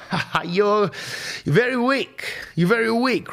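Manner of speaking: put-on high, laughing Chinese-accented taunt; mocking masseuse voice. Masseuse mocking her client, high accented taunt. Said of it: "And she's like".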